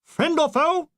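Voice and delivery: very high shouted halt; shouted challenge. Acted. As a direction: bark like a sentry. Sentry challenge.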